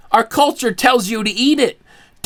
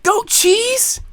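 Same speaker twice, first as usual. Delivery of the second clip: incredulous; 10 semitones higher, slower than usual. Acted. Incredulous closing question.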